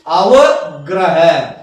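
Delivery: अवग्रह slowly split as "ava... graha"; drawn-out syllables. Shown as a demonstration. Teacher repeating the term for learners, slow and syllabic. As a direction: say अवग्रह very slowly, in separate parts.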